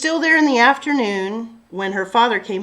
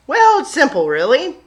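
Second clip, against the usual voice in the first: folksy farmer; 5 semitones higher, 7 dB louder than usual. Acted. Farmer explains to Sophie.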